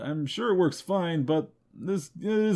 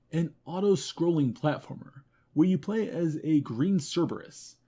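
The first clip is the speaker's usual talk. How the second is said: ordinary talk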